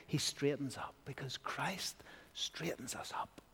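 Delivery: soft reverent whisper. Real, not acted. Preacher whispering how Christ straightens us up. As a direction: whisper it like a secret. Intimate aside.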